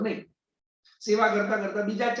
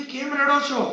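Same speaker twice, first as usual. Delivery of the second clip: higher, louder worried asking; concerned question; 5 semitones higher, 5 dB louder than usual. Acted. Son asking the weeping old man, concerned and louder. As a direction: press him, puzzled and worried. Son asks why father weeps.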